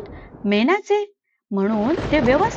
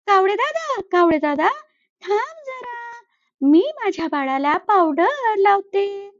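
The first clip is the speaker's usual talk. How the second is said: sweet and busy, put him off gently; busy mother sparrow; 7 semitones higher than usual. Acted. Sparrow answers the crow while bathing her baby, high and sweet.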